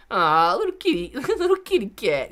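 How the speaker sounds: high, laughing coo at a kitten. Real, not acted. Streamer cooing at the kitty sound a viewer sent, laughing and high. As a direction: coo at it like a tiny cat.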